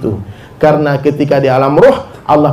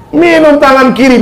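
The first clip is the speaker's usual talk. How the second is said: higher, louder warning cry; emphatic warning; 9 semitones higher, 4 dB louder than usual. Acted. Preacher warning the crowd against drinking left-handed, loud and emphatic. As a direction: call it out as a stern warning.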